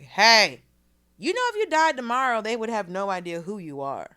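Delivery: loud, high teasing call-out at a classmate; loud mock call-out. Teen mocking a classmate in class, loud and high. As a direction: call her out loudly across the classroom. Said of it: "I was like".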